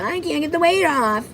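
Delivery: high-pitched imitation of her complaining; put-on high complaining voice; mock complaint. Mocking her complaint about weight.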